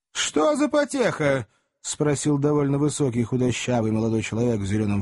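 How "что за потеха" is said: high, drawn-out newcomer's query; calling question. Acted. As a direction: pitch the question high over the crowd. (высокий протяжный вопрос)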